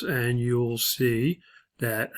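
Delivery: normal talking voice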